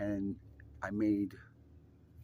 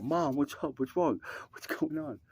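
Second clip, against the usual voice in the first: higher-pitched urgent questions; alarmed concern; 6 semitones higher than usual. Retold or imitated. Son running up to his mother, mildly alarmed. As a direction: imitate a worried son checking on mom.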